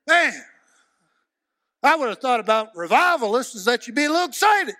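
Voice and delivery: high, loud rebuke from the pulpit; preaching at full force. Acted. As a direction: chide the revivalists, full voice.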